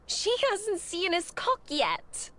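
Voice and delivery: high, slow teasing cry; laughing shout. A narrator's reading. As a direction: blurt it out mid-laugh. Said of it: "burst out laughing and shout".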